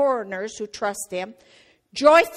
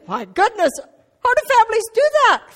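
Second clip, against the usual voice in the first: much higher, louder, fast astonished inner voice; amazed thought; 10 semitones higher, 6 dB louder than usual. Retold or imitated. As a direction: voice your bewildered younger self. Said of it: "And I'm thinking".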